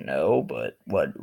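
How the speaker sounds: low, slow answer; reply low and slow, unfazed; unbothered reply. Acted. Answering the bully in a sketch, unbothered. Acted calm replier in sketch.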